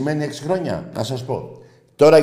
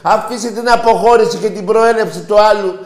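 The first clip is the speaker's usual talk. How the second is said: high, forceful raised voice; loud impassioned rant; 9 semitones higher, 7 dB louder than usual. Real, not acted. Host cuts off a doubting Olympiakos fan, loud high rant. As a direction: override the caller at full volume.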